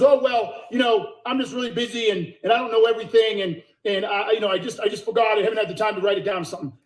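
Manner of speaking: mocking the sales rep. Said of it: "And he goes".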